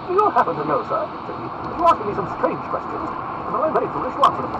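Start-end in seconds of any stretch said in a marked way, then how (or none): 0.1-1.0 high, loud lordly challenge; ringing aristocratic disdain; haughty. Acted. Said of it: "looked haughtily at the speaker". 1.5-4.5 high, loud lordly rebuke; ringing aristocratic disdain; haughty. Acted. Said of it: "looked haughtily at the speaker".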